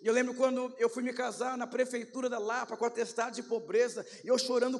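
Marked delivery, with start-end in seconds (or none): none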